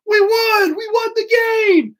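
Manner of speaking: very high, loud victory shout; triumphant yell. A narrator's reading. Tom yelling the team's victory, high and loud. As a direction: shout the win to everyone. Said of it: "Then Tom yelled".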